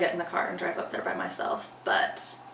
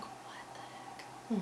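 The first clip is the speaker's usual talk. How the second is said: whispered alarm; 14 dB quieter, much slower than usual. Retold or imitated. Alarmed inner reaction to call.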